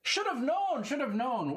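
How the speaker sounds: very high, giddy repeats; flustered. Acted. Lot kicking himself for missing the star, high and giddy. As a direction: scold yourself with a laugh.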